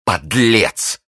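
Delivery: high, indignant outburst; outraged exclamation. A narrator's reading. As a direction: fling the insult out in outrage. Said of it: "воскликнула миледи". (возмущённый возглас)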